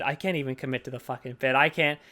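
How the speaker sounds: usual speaking voice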